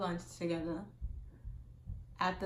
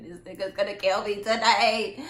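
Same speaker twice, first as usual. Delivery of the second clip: mock panic; 11 dB louder than usual. Retold panic while being spun.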